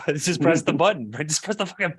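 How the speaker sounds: high-pitched exasperated insistence; exasperated husband. Retold or imitated. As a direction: plead in mock frustration. Acted frustrated husband insisting.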